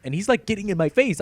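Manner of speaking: ordinary delivery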